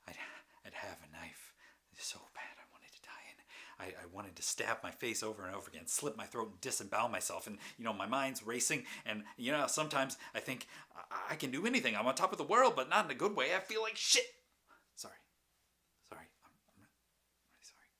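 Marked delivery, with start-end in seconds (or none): 3.8-14.3 racing thoughts building to a scream; manic escalation. Acted. 15.0-17.9 very soft, faltering apology; subdued apology. Acted. Apologising right after the outburst.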